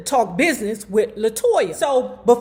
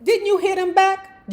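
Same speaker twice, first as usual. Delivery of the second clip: higher, agitated question; agitated repeat; 8 semitones higher than usual. Acted. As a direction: Jermaine presses the point. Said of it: "still agitated".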